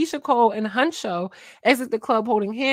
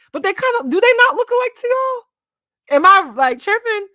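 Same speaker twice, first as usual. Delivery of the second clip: high, louder disbelieving plea to the chat; incredulous asking; 12 semitones higher, 8 dB louder than usual. Real, not acted. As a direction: ask the chat if you're crazy, pitched way up. Asks viewers about lookalikes.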